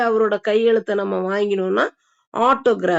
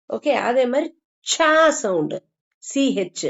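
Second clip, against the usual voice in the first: normal talking voice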